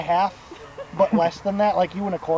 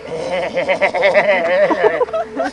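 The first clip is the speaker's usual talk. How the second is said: loud rattling mock laugh; imitated creepy laugh; 3 semitones lower, 4 dB louder than usual. Old guy across the catwalk, imitated laughing. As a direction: cackle on like an odd old man.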